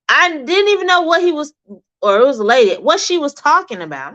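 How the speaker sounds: ordinary talk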